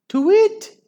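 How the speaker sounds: high eerie owl tweet; eerie owl call. Acted. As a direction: whistle it like a hunting owl. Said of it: "an eerie wavering call sounded".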